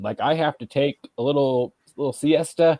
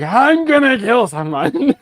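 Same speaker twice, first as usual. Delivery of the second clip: much higher, louder and slower, hammed-up fury; mock rage; 10 semitones higher, 6 dB louder than usual. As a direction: play the boiling-over parent for a laugh. Burning anger, joking.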